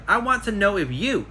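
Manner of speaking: "you" pushed hard and high at the end; stress on you. Retold or imitated. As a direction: land the weight on "you" like a finger poke. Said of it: "I emphasized the word".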